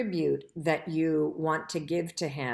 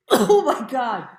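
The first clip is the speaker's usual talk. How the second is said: louder coughing, slowed exclamation; cough then gasp; 5 semitones higher, 11 dB louder, slower than usual. Real, not acted. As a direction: cough it out, then groan oh my God. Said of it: "something stuck right here in my throat".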